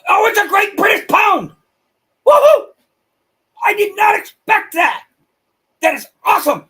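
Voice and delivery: loud, high-pitched excited shout; real burst of delighted excitement. Real, not acted. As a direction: shout it out, thrilled at the surprise gift. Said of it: "Sorry, I get excited when I see that".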